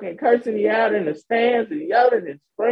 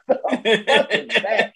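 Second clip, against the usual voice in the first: ordinary talk, nothing marked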